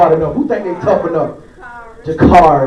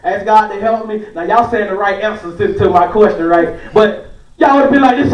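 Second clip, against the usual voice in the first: ordinary talk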